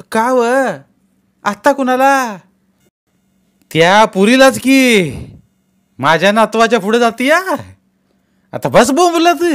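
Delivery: put-on high, loud old woman's grumble; old grandmother voice. A narrator's reading. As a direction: grandmother complains about the neighbour. Grandmother answers in bed.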